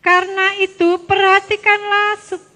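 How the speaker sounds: loud, measured read-aloud; scripture reading. Real, not acted. Teacher reading Luke with the children, loud recitation. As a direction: project the verse for everyone to follow.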